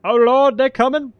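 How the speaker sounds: high, loud panicked cry; alarmed shout. Real, not acted. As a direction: yell in sudden alarm. Enemies attack in game.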